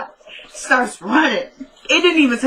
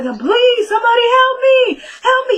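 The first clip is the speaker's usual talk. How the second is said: high, loud frantic screaming plea; panicked cry for help; 4 dB louder than usual. Retold or imitated. Retelling the victim, shrieking for help. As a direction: shriek it like she's being choked.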